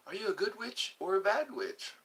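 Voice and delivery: high, lilting put-on voice; acted film line. Asking a stranger about witches, high put-on voice. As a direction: lift it into a storybook lilt.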